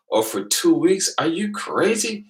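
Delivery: high, slow incredulous scoff; incredulous mockery. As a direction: stretch it out in disbelief. Mocking their numbers.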